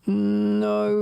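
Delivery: anguished shout of no; yelling from the sidelines. Real, not acted. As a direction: cry out at the miss. Fans reacting to a chance.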